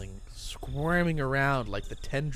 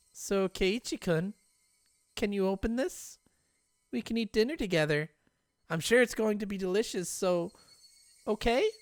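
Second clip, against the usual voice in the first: coaxing Rena; 8 semitones higher than usual. Acted. Rena coaxing Keiichi to open the door, sweetly. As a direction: lure him in with sugary sweetness.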